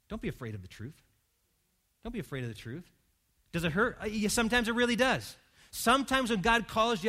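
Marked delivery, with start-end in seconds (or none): 0.1-1.0 gentle reassurance. Acted. Repeated soft appeal. 2.0-2.9 gentle reassurance. Acted. Repeated soft appeal.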